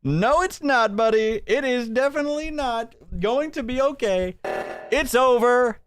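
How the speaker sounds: high, mocking gloating voice; taunting mockery. Streamer taunting the creator in a high mocking gloat.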